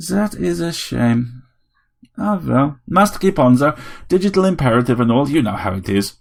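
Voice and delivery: a tiny robot playing a grand vampire; robot Dracula. A narrator's reading. Vampire robot telling Harry it wants all his blood.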